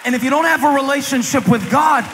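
high, forceful pulpit delivery; impassioned preaching. Real, not acted. Preacher urging the congregation toward God, impassioned. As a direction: preach it hard, drawing out the last words.

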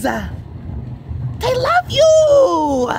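high, very slow cooing at the pet. Acted. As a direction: coo They love you like baby talk.

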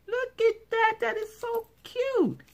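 very high squeaky squeal of delight. Real, not acted. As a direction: squeal it out like she can't contain herself. Squealing over a cute gift.

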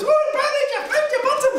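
very high Scottish bird voice; squeaky little Scottish accent. Acted. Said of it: "Bird was found to be Scottish".

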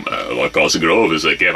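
changed cartoon voice. Dubbing take with changed voice.

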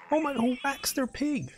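put-on very high, far-off old lady squeal; shocked old lady. Old lady shocked at the player whacking the pig. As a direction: gasp it out like a scandalised granny.

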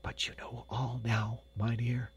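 soft consoling whisper. A narrator's reading. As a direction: whisper it gently to comfort him. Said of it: "whispered Hans".

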